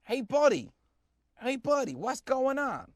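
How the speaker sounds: high, loud New Jersey accent, "buddy" as "baddy". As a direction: greet like a New Jersey guy. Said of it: "Is that a Jersey accent?".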